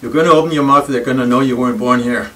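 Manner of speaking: usual speaking voice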